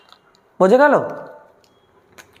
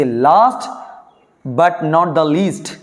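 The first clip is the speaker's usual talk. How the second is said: slow dictation; 4 semitones lower, much slower than usual. Shown as a demonstration. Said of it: "কোনো important যখন কথা বলবে".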